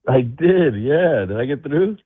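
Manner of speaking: usual speaking voice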